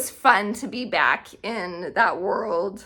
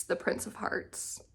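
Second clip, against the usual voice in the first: swooning whisper; 12 dB quieter than usual. Real, not acted. Naming her fictional crush.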